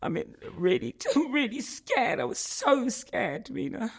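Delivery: fighting back real tears as you speak; choking up. Real, not acted. Telling Davina how scared she was, choking up in real tears. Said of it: "it's making me choke up again".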